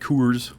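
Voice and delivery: COORS with a drawn-out local vowel; local O sound. Shown as a demonstration. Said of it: "the way they say their O's".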